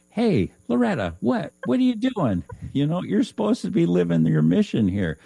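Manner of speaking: high, loud mock scolding that fades toward the end; scolding spirit guide. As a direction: play a guide giving a wake-up smack. Guide scolds Loretta in story.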